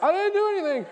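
high, loud, laughing mock protest; defensive innocence. Pastor imagining denying the butt slap, high and laughing. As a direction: squeak out your innocence. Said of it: "And I was like".

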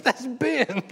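high, giggling recognition; laughing as it dawns on him; laughing realization. Retold or imitated. Pastor laughing as he realizes the caller is Ben.

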